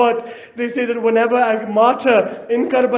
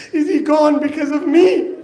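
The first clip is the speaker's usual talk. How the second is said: sobbing; 6 semitones higher than usual. A narrator's reading. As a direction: voice breaking into a sob, pitched high. Sob heard mid-lament.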